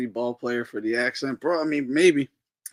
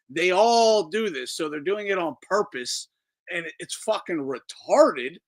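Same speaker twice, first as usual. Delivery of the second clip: ordinary delivery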